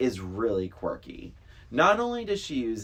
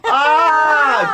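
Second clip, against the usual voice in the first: loud, high delighted cry; thrilled shout of approval; excited cheer; 14 dB louder than usual. Real, not acted. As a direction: whoop with glee. Cheering Christina's Nomi joke.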